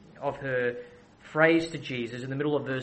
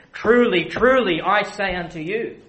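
emphatic proclamation; 3 semitones higher, 8 dB louder than usual. Acted. Emphatic quoted Jesus formula.

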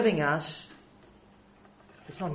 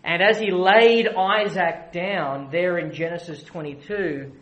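usual speaking voice

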